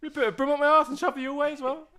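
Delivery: squeaky high put-on voice, loud; exasperated Tom. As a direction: play a flustered bloke caught in the act. Continues Tom's reply.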